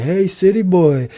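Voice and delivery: high-pitched mocking kid call; taunting schoolkids. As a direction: tease like kids in the schoolyard. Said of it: "they started off calling me city boy".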